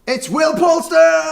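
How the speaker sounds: big loud host introduction; hyped-up loud reveal; announcer shout. Real, not acted. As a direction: announce the guest like a showman.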